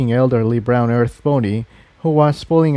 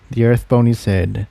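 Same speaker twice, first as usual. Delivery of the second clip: plain reading voice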